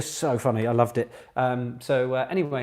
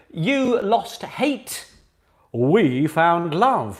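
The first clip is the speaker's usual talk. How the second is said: very slow drawn-out reading; 3 semitones higher, 4 dB louder, much slower than usual. Shown as a demonstration. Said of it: "drawn-out quiz reading".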